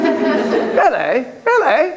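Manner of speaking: high, rising mock-Irish questioning; hammed-up Irish lilt; ridiculous Irish brogue. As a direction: squeak it out in a silly Irish accent. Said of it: "sorry for the ridiculous accent".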